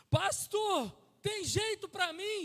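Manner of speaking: loud, pleading cry of a prisoner, slow at the end; desperate plea. Retold or imitated. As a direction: shout it out as a broken man begging. Prisoner's crying plea retold.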